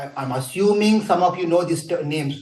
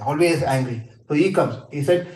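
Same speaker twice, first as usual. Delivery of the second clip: usual speaking voice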